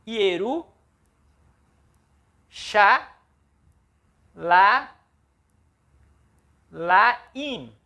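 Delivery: Ieru, chá, laim, each syllable separated and stressed. Shown as a demonstration. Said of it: "vamos lá, com outra cor". (sílaba por sílaba, destacando chá)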